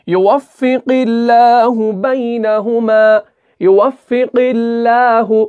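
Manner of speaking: slow recitation of "युवफ़िक़िल्लाहु" with a thin, light लाम; thin lam recitation. Shown as a demonstration. Teacher demonstrates for students the thin lam, slow chant. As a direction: draw out "युवफ़िक़िल्लाहु बैनहुमा" slowly, लाम kept thin. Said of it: "कैसे?".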